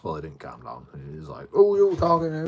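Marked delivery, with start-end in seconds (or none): 1.5-2.5 high, loud mock confrontation; aggressive challenge. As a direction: mimic a man squaring up.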